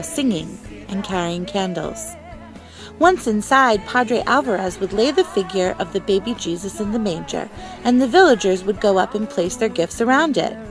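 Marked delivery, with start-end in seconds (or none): none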